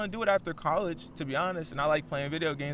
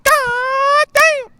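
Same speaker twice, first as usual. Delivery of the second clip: very high, loud squeaky God damn; high-pitched God damn; 14 dB louder than usual. Retold or imitated. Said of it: "my high-pitched voice".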